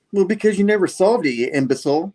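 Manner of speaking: high, loud mock jeer; taunting. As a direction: rub it in with acted scorn. Imagined Spider-Man taunts villain.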